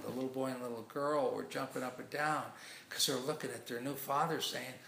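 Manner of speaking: ordinary delivery, nothing marked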